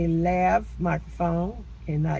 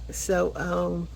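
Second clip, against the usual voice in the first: usual speaking voice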